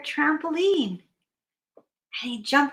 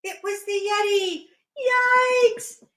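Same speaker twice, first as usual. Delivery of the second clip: alarmed; 7 semitones higher than usual. Acted. Hippo discovers the Yeti, high mock alarm. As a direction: squeak with cartoon alarm.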